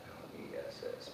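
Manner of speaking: letters whispered; whispered spelling. Shown as a demonstration. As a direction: breathe the letters L E S S. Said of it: "M O R E".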